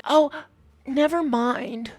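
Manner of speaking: slow, drawn-out brush-off; mock dismissive friend. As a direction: drop it with a shrug. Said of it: "it's just like".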